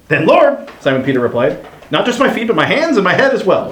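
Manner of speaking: high, eager plea; eager Peter. Acted.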